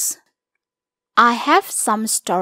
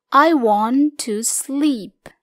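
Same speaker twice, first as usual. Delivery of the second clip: slow model reading; much slower than usual. Shown as a demonstration. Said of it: "Kamu bisa gunakan kalimat-kalimat berikut".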